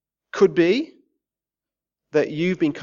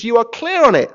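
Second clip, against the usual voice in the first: usual speaking voice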